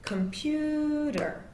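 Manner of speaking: COMputer with long drawn vowels, high and slow; syllable length stretched. Shown as a demonstration. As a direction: stretch each syllable long and slow like pulling a rubber band. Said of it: "we go to the side".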